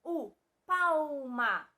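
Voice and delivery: "palma" drawn out slowly as "pau-ma"; L sounded as U. Shown as a demonstration. Teacher shows that L sounds like U, slow sounded-out "palma". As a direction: stretch "palma" with the L as a loud, long U. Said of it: "O L possui o som de".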